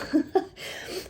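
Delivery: a quick, hushed laugh at being moved; soft emotional laugh. Real, not acted. Reader laughs at getting emotional during the reading, soft laugh. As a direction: laugh softly, caught off guard by feeling. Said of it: "these readings are making me emotional".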